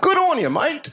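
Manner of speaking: high, hammed-up Aussie "Good on you, mate"; mock Aussie accent. Comic imitating audience member's accent, hammed-up Aussie. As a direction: tease the crowd in a put-on Aussie twang.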